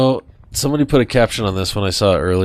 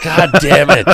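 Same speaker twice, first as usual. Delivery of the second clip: mock exasperation; 4 semitones higher, 6 dB louder than usual. Voices the exasperated rescuers, laughing around.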